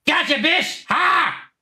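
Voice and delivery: ordinary talk, nothing marked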